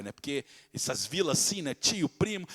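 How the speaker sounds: ordinary talk, nothing marked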